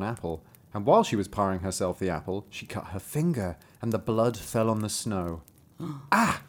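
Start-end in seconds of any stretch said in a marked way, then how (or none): 6.1-6.4 high sudden "ah"; short high exclamation as the storybook woman; acted little cry, pitched up; woman's cry. A narrator's reading. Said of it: "said the woman".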